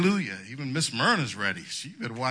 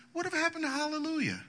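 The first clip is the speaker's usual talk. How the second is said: mock puzzled churchgoer; 3 dB quieter, faster than usual. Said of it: "Next two weeks is".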